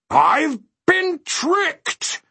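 very high, slow, loud wail; drawn-out anguished exclamation; helpless cry. A narrator's reading. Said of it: "cried the mathematician helplessly".

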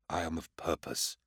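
soft brooding mutter. A narrator's reading. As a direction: mutter it under your breath, to himself. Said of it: "he muttered".

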